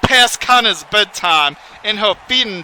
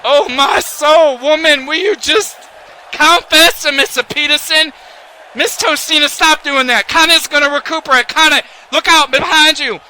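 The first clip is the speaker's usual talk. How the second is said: much higher, louder, panicked play-by-play; frantic excitement; 7 semitones higher, 4 dB louder than usual. Real, not acted. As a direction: shout at the screen as the match turns. Frantic commentary at match climax.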